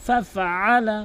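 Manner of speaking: slow, melodic tajweed recitation; Quran recitation. Acted. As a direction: chant it slowly, holding the long vowels. (সুরেলা ধীর কোরআন তিলাওয়াত)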